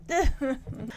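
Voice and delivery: real laugh breaking into a squeaky cry; can't hold back the giggles; helpless laughter. Real, not acted. Reviewer cracking up at Freddie's awkward moment, real laughter.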